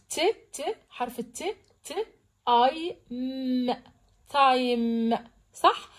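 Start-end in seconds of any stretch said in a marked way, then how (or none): 0.1-0.8 ت as a short, soft separate t sound; letter by letter. Shown as a demonstration. Teacher reading time letter by letter, isolated sounds. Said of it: "تعالوا نقرا حرف حرف". 1.8-5.2 ت، اي، م slow and separate, then تايم blended; sounded out. Shown as a demonstration. Teacher reading time letter by letter, slow sounding-out. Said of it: "تعالوا نقرا حرف حرف".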